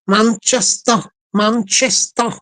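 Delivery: put-on Mancunian accent; Manchester accent. Shown as a demonstration. Man trying out the city's name, in Manchester accent. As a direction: lean into a Manchester twang. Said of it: "So why they say Manchester?".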